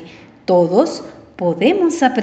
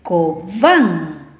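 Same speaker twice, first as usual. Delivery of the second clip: "Cobán" with low CO and raised, long BÁN; stress on last syllable; 5 semitones lower than usual. Shown as a demonstration. Teacher asks pupils to listen, "Cobán" stressed on BÁN.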